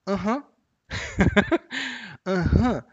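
Aham with a light rising tune; bright, rising Aham of agreement; aham demo. Shown as a demonstration. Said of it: "A próxima é".

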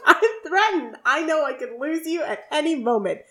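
high-pitched, lightly hammed-up protest; mock threatened. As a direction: insist on feeling threatened without really meaning it. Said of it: "You don't sound very threatened".

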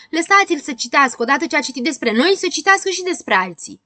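high, loud clamouring demand; shouting. Acted. Mocked friends demand others be mocked too, shouting. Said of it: "Grăbilă și Probabil strigau".

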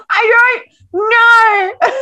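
high, loud drawn-out wail; mock hysterics. Sobbing reader answering partner across the couch, mock hysterics. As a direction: wail it slowly, imitating a tearful meltdown. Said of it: "tears, hysterics".

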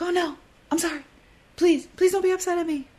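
high, fast, pleading sorry; anxious apologizing. Retold or imitated. A worried people-pleaser pleading with someone not to be upset. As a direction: beg them not to be upset. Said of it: "And you're like".

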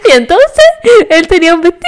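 voz aguda, al borde del llanto, en broma; llanto imitado. Retold or imitated. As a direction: contar la historia lloriqueando, exagerado. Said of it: "¿Por qué está llorando por el vestido?". (imitación de sí misma llorosa)

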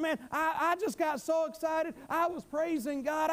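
normal talking voice

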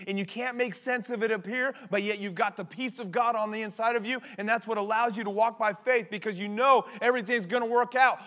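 ordinary delivery

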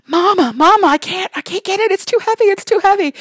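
put-on high-pitched little girl's plea; daughter calling for help. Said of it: "she calls to me".

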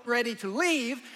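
normal talking voice

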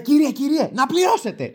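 high, insistent mock clerk voice; hammed-up assistant demanding attention; imitated calling clerk. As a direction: call a customer back in a put-on high voice. Clerk calls him back to pay.

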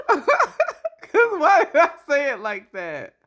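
high laughing through the words; laughing too hard to talk; hysterical laugh. Real, not acted. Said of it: "scary as fuck".

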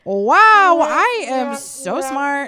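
loud, high, drawn-out self-praise; mock self-congratulation. Congratulating herself on guessing the lie, loudly and slowly boastful. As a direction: stretch it out, amazed at yourself.

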